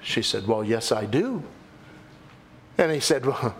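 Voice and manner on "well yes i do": put-on low, unruffled old lady's reply; old saintly lady. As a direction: answer simply in a much lower voice. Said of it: "the old saintly lady".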